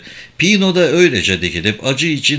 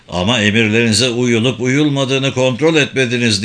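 ordinary delivery, nothing marked

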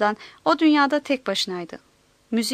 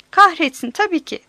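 loud first word, higher pitch; impatient; 4 semitones higher, 6 dB louder than usual. A narrator's reading. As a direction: exclaim it as if fed up with waiting. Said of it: "dedi Grimes tezgaha sabırsızlıkla vurarak". (sabırsızca çıkışarak)

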